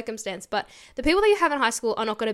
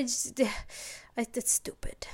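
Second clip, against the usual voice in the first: very soft, trailing-off dismissal; deflated mutter; 8 dB quieter than usual. Real, not acted. Venting about her old friends' reply, deflated and hushed. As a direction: let it drop away under the breath.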